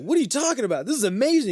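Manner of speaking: high, excited mock disbelief; incredulous king. King answering the angry host, incredulous. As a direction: gush in baffled delight. King baffled by host's anger.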